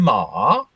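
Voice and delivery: "ma" stretched, dipping deeply then rising; Chinese tone demo. Shown as a demonstration. Teacher showing a third meaning, "ma" long and dipping. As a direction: drop "ma" and swing it back up. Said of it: "it's the tone that you change".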